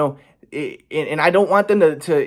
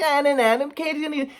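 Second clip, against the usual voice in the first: high sing-song taunt; mock him like a teasing kid; taunting mom voice; 11 semitones higher than usual. Son imitates mom taunting him, high sing-song. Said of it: "She's like".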